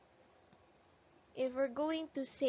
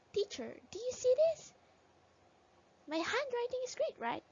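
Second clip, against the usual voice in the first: put-on child's voice; 12 semitones higher than usual. Child voice interrupts.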